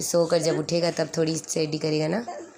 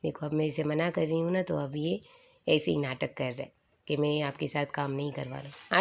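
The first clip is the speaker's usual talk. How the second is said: ordinary delivery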